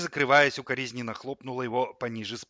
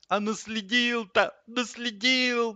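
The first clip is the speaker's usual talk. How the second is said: high, drawn-out groaning lament; moaning; 12 semitones higher, 4 dB louder, slower than usual. A narrator's reading. Linoleum laments the muddy tracks, high moan. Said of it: "простонал линолеум".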